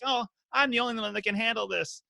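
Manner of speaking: hammed-up self-pitying hero whine; whiny martyr. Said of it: "that's the kind of whininess".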